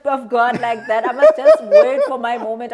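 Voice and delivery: laughing through the words; high, loud, laughing it off. Real, not acted. Laughing while joking about stealing.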